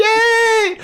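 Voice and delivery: very high squealed yay; gleeful cheer. Real, not acted. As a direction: squeal with delight. Cheering the innuendo joke.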